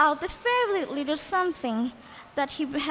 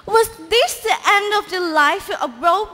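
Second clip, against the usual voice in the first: ordinary delivery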